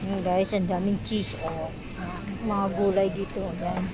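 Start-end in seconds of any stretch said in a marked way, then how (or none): none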